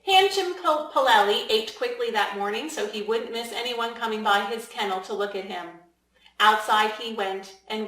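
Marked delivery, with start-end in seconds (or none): none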